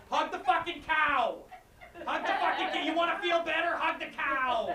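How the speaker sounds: high, strained mock yell held down in volume; Danny Shy impression. Unsympathetic cow therapy farmer ordering patients to hug, high strained mock yell. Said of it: "That's my Danny Shy impression".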